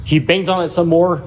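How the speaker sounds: ordinary talk, nothing marked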